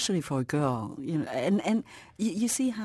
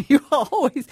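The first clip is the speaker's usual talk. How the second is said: laughing; 9 semitones higher, 12 dB louder than usual. Real, not acted. Laughing at own remark.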